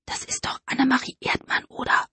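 hushed aside to a friend; whisper. Acted. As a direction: whisper it so nobody else hears. Frauke neigt sich zu Luise. (Frauke flüstert Luise heimlich zu)

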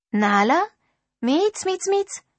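high, sing-song kitty call; lilting, questioning pet call; calling a cat. Acted. Calling her cat in the dark.

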